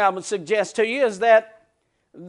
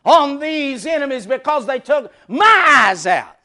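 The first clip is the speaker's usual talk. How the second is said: loud, slow pleading shout; Samson's shouted vengeful prayer; 5 semitones higher, 9 dB louder, slower than usual. Retold or imitated. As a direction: cry out to God for revenge. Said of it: "He said".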